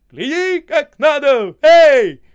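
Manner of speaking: loud, high ringing call; ringing shout. A narrator's reading. Osip calling out while leaping across ice floes. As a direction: call out proudly, showing off. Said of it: "звонко кричит".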